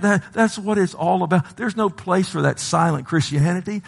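ordinary talk

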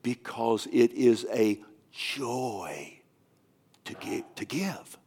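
slow, fading into a whisper; choked up, trailing off into breath; tearful whisper. Retold or imitated. Crying man explains his weeping.

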